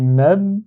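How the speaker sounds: "mab" as joined "mab-ba", lips held shut on the b; "mab" glued into one word, "mabba"; doubled closed b. Shown as a demonstration. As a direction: hold the double b in "mab" without releasing it. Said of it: "je le ferme parce qu'il y a shadda".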